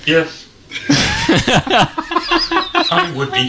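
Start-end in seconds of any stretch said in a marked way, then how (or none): 0.7-1.7 high burst of laughter; bright laugh. Real, not acted. Laughing at robot joke.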